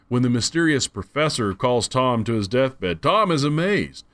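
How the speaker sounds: usual speaking voice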